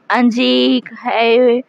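put-on long, slow drunken call to Anjik; drawn-out call. A narrator's reading. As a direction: stretch the name out, calling across the lawn. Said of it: "वो ज़ोर से आवाज़ देने लगी". (नशे में धीमी, खिंची हुई पुकार)

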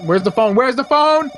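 loud, rising urgency; urgent, high-pitched calling out; frantic. Real, not acted. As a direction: raise your voice, urgently hunting for the phone. Frantic search as the phone rings.

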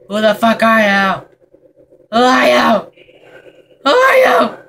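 panicked demand at a shadowy figure; shouting. Real, not acted. Yelling at a figure in game.

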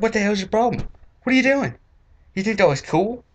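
high, indignant yelling; mock-furious high-pitched scolding; outraged rant. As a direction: tear into them, incredulous. Ranting at guys with flipped collars.

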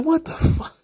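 high, incredulous what the fuck; mock outrage at a silly idea; baffled disbelief. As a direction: recoil at something absurd. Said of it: "And I was like".

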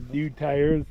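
ordinary delivery

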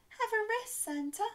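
high, loud elf exclamation; elf's cry. A narrator's reading. Elf urging Santa to rest, high loud cry. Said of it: "cried an elf".

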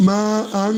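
"মা" stretched long before "আং"; four-beat elongation. Shown as a demonstration. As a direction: hold the vowel of "মা" for four beats, then hum "আং". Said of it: "চার আলিফ টেনে পড়তে হবে".

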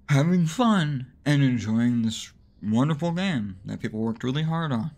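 usual speaking voice

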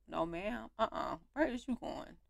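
usual speaking voice

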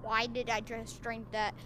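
usual speaking voice

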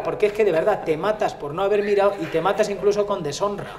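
normal talking voice

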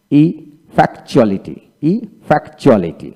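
ইফেক্টুয়ালিটি slowly, pausing after the first syllable; broken into syllables. Shown as a demonstration. Teacher walking learners through ইফেক্টুয়ালিটি, slow and in pieces. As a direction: split ইফেক্টুয়ালিটি into slow chunks. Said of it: "এইভাবে ভেঙে ভেঙে".

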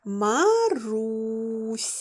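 Маруся stretched very slowly; Маруся dragged out so each sound is heard. Shown as a demonstration. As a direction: draw out every sound. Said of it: "Вимовте його".

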